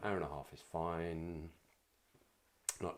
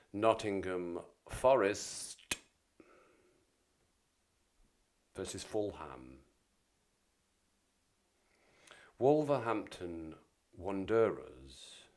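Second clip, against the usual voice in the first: fixtures announcer voice; 5 dB louder, slower than usual. Pretend. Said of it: "Back into character".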